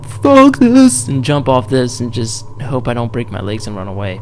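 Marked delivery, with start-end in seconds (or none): 0.0-1.1 sudden mock yell, much higher and louder; exasperated hypothetical shout; imagined outburst. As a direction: blurt it out in exasperation. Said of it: "I would just be like".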